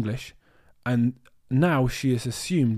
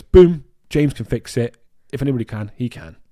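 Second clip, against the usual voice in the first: confident boast; 8 dB louder than usual. Retold or imitated. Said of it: "Isabella just instantly goes".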